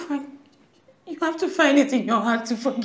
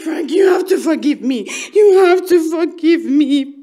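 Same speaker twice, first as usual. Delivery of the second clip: desperate shout; 11 dB louder than usual. Acted. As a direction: drag each word out in a loud wail. Hysterical plea continues.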